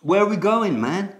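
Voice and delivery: high, loud casual American question. Shown as a demonstration. Teacher showing how Americans add man, American accent. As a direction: toss it off like a laid-back dude.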